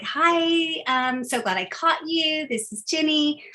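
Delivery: high-pitched, welcoming hello to a donor; friendly phone greeting. Shown as a demonstration. Caller glad to reach a donor on the phone, friendly and high. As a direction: greet them gladly, pitched well up.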